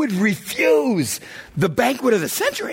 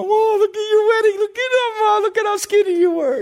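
much higher, excited guest gushing; 12 semitones higher than usual. Retold or imitated. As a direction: squeal over the old photo like a visiting aunt.

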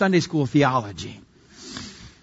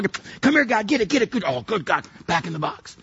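calling a dog; 4 semitones higher than usual. Acted. Preacher calling God to fix a problem like a pet, dog-owner voice. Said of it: "treated God like a big dog".

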